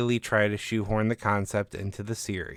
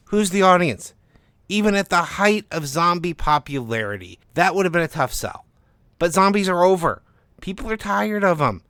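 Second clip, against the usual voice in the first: higher, louder, dismissive agent voice; skeptical literary agent; 7 semitones higher, 6 dB louder than usual. Acted. Agent on the phone scoffing at the zombie book, higher and louder. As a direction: scoff at the idea as the pushy agent.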